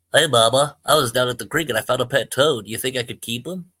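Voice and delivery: put-on a boy proudly showing off his find; gleeful boy. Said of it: "his face wearing a gleeful smile".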